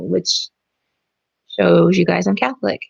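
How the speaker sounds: usual speaking voice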